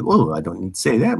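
normal talking voice

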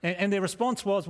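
usual speaking voice

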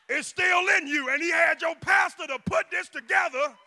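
loud, high whooping preacher climax; full-force preaching. Acted.

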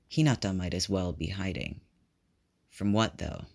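plain reading voice

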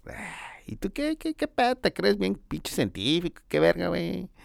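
high, mocking squeal at Elon; mocking challenge. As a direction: squeak up high to taunt him. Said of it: "Y le digo". (burla en voz muy aguda al retar a Elon)

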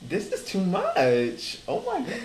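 slow, then a high squeal on oh my god; mock panic at being put on the spot; flustered, drawn out, pitch shooting up; overwhelmed squeal. Overwhelmed by class staring at them.

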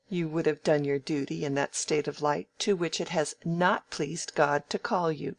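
ordinary delivery

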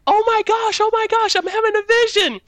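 put-on high-pitched squealed retelling of childlike amazement; excited child. Kid amazed by glowing clouds, excited squeal. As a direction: gush breathlessly like an overexcited little kid.

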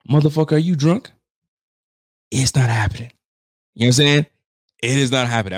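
loud, incredulous yell; outraged shout. Real, not acted. Host shouts at the analyst over Miami's chances, furious. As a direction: shout him down, it's not happening.

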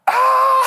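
loud, high-pitched exasperated shout; frustrated yell. Retold or imitated. Dad hears the order wasn't started, loud high frustrated yell. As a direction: let out a sharp cry of frustration.

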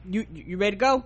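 ordinary talk, nothing marked

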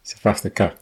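a light, half-hearted South African accent on "South Africa". Shown as a demonstration. Saying the country's name in a mock South African accent. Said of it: "We need to say South Africa".

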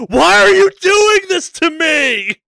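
imitated high, loud anguished wail; mock meltdown, slow and far up in pitch; cracking-open outburst. Co-host imitating friend cracking open in a podcast, mock meltdown. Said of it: "watch you crack open in a podcast".

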